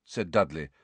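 plain reading voice

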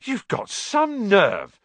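slow, loud, high-pitched outrage. A narrator's reading. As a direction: stretch it out loudly, affronted. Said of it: "said Ron loudly".

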